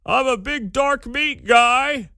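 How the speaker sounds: high, slow, drawn-out mock gushing; Rex Archer. Host mocking how the health director prefaced it, hammed-up imitation. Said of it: "He prefaced it by saying".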